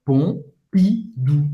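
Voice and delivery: Pompidou drawn out slowly, nasal pom; slow French pronunciation. Shown as a demonstration. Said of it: "O M tem o som do on".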